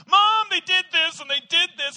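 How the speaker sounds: put-on high, loud whining kid voice; whiny tattling boy. Little boy tattling to his mom, high and loud. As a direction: tattle on your brothers in a high, loud whine. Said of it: "And I'd come to my mom".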